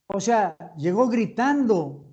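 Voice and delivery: ordinary talk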